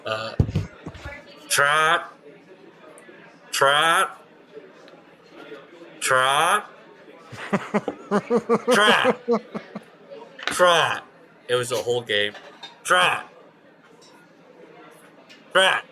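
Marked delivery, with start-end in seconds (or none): 1.5-11.0 high, loud mocking call of Trot; heckling fan calls. Fan in the stands calling to the player, high and loud. 12.8-15.8 high, loud mocking call of Trot; heckling fan calls. Fan in the stands calling to the player, high and loud.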